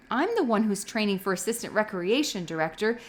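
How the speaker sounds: usual speaking voice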